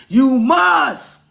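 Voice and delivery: high, loud shouted command; shouted "you must" from the pulpit; preaching shout. Acted. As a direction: bellow it like a preacher at the peak.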